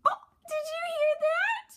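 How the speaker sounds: high, bright squeal of delight; thrilled the toy made a sound; excited high gasp at the noise; delighted surprise. Real, not acted. Toy dog makes eating noises.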